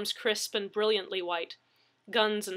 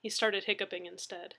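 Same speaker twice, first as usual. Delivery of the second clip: ordinary delivery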